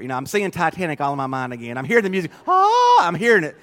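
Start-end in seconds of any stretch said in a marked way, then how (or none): 2.5-3.1 short high mock yelp of panic; mock scream. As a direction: yelp like you're going down with the ship. Imagining the ship sinking.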